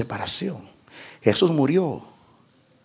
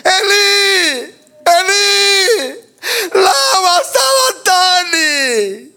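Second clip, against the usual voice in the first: high, tearful, slow wail; sobbing cry from the cross; 6 semitones higher, much slower than usual. Acted. Preacher acting Jesus forsaken on the cross, tearful high wail. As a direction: weep out the words of abandonment.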